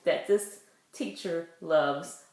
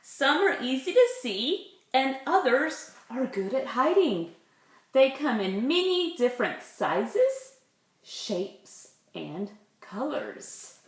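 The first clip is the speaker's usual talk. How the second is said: put-on lively read-aloud for little kids; reading to children; 4 semitones higher, 5 dB louder than usual. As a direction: read it out like storytime. Reading picture book aloud.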